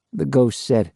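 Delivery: ordinary delivery, nothing marked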